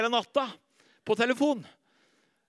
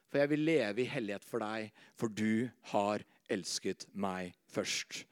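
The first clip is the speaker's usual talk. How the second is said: much softer, lower and slow; soft prayer; 9 semitones lower, 6 dB quieter than usual. Acted. Preacher voicing his prayer to Jesus, soft and slow. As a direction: pray it gently.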